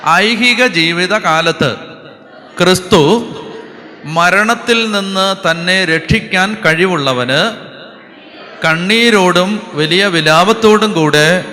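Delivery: slow, loud scripture reading. Shown as a demonstration. Preacher leads congregation reading Hebrews aloud, slow and loud. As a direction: proclaim the verse phrase by phrase for all to follow. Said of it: "ഉറക്കെ വായിക്കണം".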